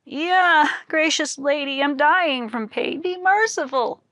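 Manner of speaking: the beaten scholar wails to his wife; crying out in pain. A narrator's reading. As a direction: cry out for mercy while being beaten. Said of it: "he cried".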